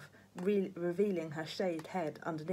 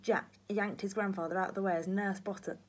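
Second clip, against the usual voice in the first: ordinary delivery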